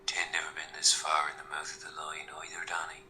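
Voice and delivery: put-on whispered Irish accent; hushed Irish whisper. Whispering in holding cell.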